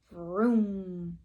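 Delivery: roaring engine sound; car vroom. Acted. Teacher making the car noise for kids. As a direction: rev it like a speeding car.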